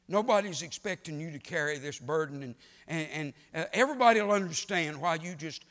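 loud, rising preacher's cry; a preacher mocking the devil's excuses at full volume; full-force preaching. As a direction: build the sermon to a loud climax.

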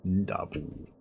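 lightly voiced orc; a hint of orc in the voice; mimicking orc grunt. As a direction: echo the game orc's acknowledgement. Echoes game orc's acknowledgement.

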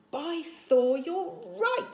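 high, loud convinced cry; amazed exclamation. A narrator's reading. The chief suddenly believes Fishlegs: loud amazed. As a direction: burst out, won over.

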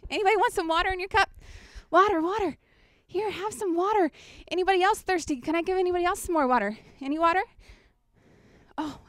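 high, bright-voiced water seller calling out to the crowd; Samaritan woman at the well. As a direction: offer water like a woman carrying a jug. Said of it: "I'd be coming in as that Samaritan woman going to the well".

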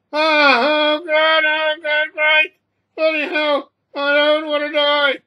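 high, loud, drawn-out cries of terror; terrified yelling. A narrator's reading. Passenger bitten by the raging cat, terrified yelling. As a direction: wail loudly, fearing for your life.

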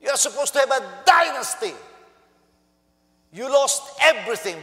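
furious preacher's rebuke; angry rebuke. Retold or imitated. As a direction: scold him as a fool who lost it all. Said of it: "What he was saying is".

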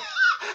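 very high startled squeal; comic high-pitched gasp of alarm; mock fright. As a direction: squeal as if horrified. Acts out the fright.

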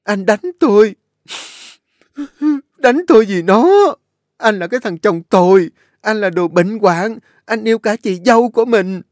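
crying outburst. A narrator's reading. An Nhã wails at husband after the slap, crying. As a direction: wail at the husband who hit her. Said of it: "ôm mặt khóc".

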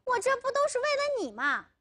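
high-pitched exasperated protest; agitated, pleading outburst; frantic. A narrator's reading. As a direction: burst out desperately, pitch way up. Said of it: "阿龙急了".

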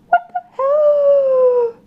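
high, slow, loud disbelief; shocked cry. Real, not acted. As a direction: shriek in disbelief. Reacts to the barf flavour.

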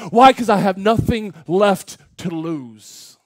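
loud, high, driving sermon delivery; impassioned preaching. Real, not acted. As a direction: preach with building force, punching key words. Preaches at full force.

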